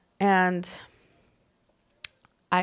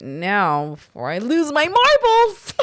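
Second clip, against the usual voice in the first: loud, slow mock scolding; stern mock threat at full voice; mean telling-off; 5 dB louder than usual. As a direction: lay down the law, drawn out and loud. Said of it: "I would say it in a mean way where I'm like".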